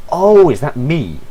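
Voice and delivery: sudden high startled question; surprised. Real, not acted. Player spotting their own character, surprised high exclamation. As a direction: jolt up in surprise.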